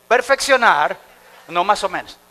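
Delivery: preacher leading a call and response; loud slow call. Shown as a demonstration. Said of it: "Una vez más, diga conmigo".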